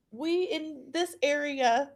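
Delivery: ordinary delivery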